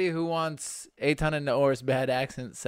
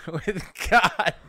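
cracking up at the punchline; laughing; 6 semitones higher, 4 dB louder than usual. Real, not acted. Echoing a friend's joke, laughing. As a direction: repeat it through laughter.

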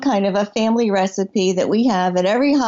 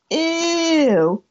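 disgusted ew; 6 semitones higher than usual. Retold or imitated. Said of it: "everyone says".

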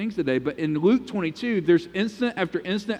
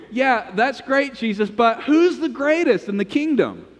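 mock clueless disciples; 7 semitones higher than usual. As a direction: play the disciples as tone-deaf, higher. Disciples dismissively ask about greatness.

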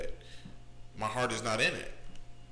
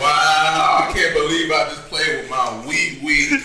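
mock disgust; 11 dB louder, slower than usual.

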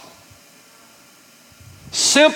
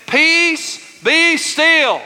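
high, held-out preacher's command; 8 semitones higher, much slower than usual. Acted. Preacher leading congregation as Jesus calming the sea, commanding. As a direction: rebuke the storm, long and high. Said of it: "Y'all say it with me".

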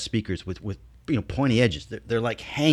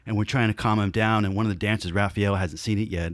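ordinary talk

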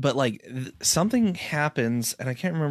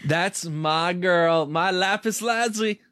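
proud cheering; 5 semitones higher than usual. Real, not acted. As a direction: cheer your favourite on, stretching the words higher. Cheering on a favourite character.

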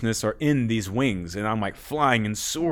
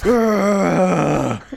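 long, high, loud wail; acted overwhelmed groan; 8 semitones higher, 6 dB louder than usual. Re-enacting the reaction to a crazy wave of energy, overwhelmed wail. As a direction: let out one drawn-out cry, hammed up.

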